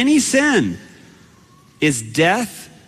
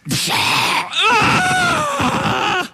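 mock scream. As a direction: scream like you've just been bitten. Recreating scream after shark bite.

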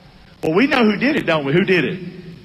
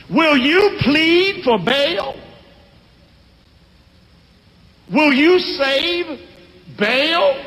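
slow, higher, drawn-out rebuke; defiant father; 6 semitones higher, slower than usual. Retold or imitated. Pastor voicing Gideon's father defying the mob slowly. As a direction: stand up to the crowd word by word.

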